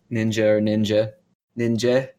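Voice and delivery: usual speaking voice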